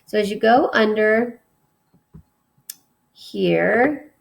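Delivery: ordinary talk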